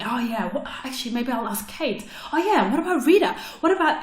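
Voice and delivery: excited brainstorming. Retold or imitated. Speaker replays herself thinking of friends to ask, excited. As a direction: tumble from one name to the next, pitch jumping up.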